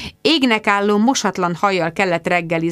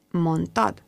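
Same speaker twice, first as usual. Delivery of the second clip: whispered; 5 dB quieter than usual. A narrator's reading.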